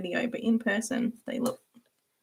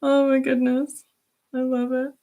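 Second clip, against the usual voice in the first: laughing; 5 semitones higher, 4 dB louder than usual. Real, not acted. Laughing at a friend's hiss.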